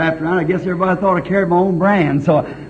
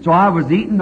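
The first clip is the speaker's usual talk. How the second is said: ordinary talk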